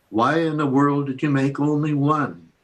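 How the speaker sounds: normal talking voice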